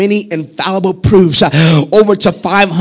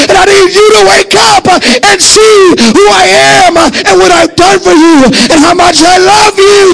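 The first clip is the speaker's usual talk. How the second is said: preaching at full force; 6 semitones higher than usual. Real, not acted. Preacher voicing Jesus showing his scars, full-force shouting. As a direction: build each line until the voice breaks into a cry.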